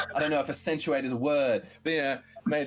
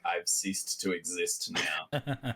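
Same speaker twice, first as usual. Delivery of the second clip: very slow, low, softer creature; dying dragon voice; 6 semitones lower, 4 dB quieter, much slower than usual. Acted. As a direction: intone it slowly as the defeated dragon. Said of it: "The dragon thing was like".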